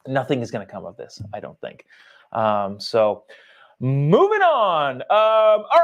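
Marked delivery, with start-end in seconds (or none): none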